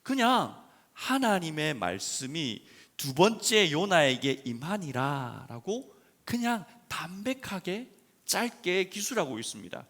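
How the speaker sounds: normal talking voice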